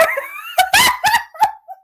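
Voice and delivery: very high squealing laugh; real shrieking laughter. Real, not acted. Reader breaking down at the insult, shrieking laughter. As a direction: burst into loud, squeaky laughter.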